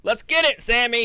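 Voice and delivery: excited cheer. Real, not acted. Cheering for Sami.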